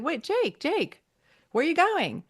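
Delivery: high-pitched acted call to stop him; calling after someone. Joe calls after Jake as he leaves, imitated urgent call.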